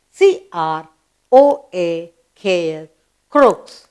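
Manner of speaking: ordinary talk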